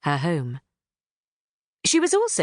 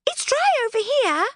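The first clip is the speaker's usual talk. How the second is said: higher pixie voice, plain observation with dry stressed; pixie observing. Acted. Thistlewit remarking it is dry, lightly higher pixie voice. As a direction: point it out, a touch puzzled. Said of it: "Thistlewit observed".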